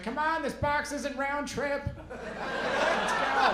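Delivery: high-pitched, impatient grandma yell; yelling, pitched well up; mock yelling. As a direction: holler at everybody, drawn out and high. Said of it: "yelling at everybody".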